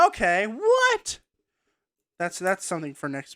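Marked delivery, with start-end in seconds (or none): 0.1-1.2 high, slow disbelieving what; baffled, stretch out the what; incredulous. Real, not acted. Incredulous reaction to change.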